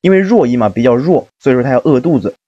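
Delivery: ordinary delivery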